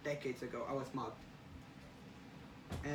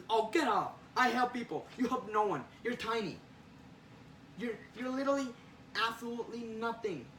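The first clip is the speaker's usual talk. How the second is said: raised-pitch, scornful put-down; heated scorn; 5 semitones higher than usual. Acted. Spider-Man telling Jonah he helps no one, scornful. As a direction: argue back hard and belittle them. Acted quarrel, belittling the opponent.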